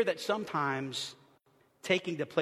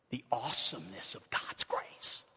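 awed whisper; 7 semitones lower, 10 dB quieter than usual. Acted. Whispered in awe.

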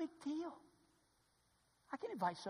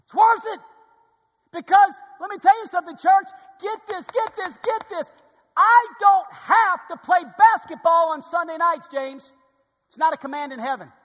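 excited shout; 9 semitones higher, 17 dB louder than usual. Real, not acted. Preacher thrilling the church with good news, loud excited shout. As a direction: shout it out with delight.

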